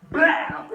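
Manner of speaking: loud, high "blaow" impact noise; imitated smashing blow. Retelling how he hacked at the ice, loud imitated blow. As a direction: punch out a comic smashing sound.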